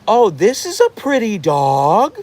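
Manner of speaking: high, loud, drawn-out cooing over a dog; exaggerated gushing. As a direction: gush showily to make your own dog jealous. Baiting jealous dog by praising another.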